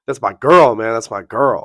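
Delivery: loud imitation of a jealous rival; mock possessive outburst. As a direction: punch it out like a worked-up boyfriend, mockingly. Jealous rival claiming the girl.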